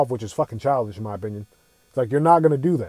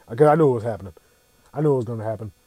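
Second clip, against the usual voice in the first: normal talking voice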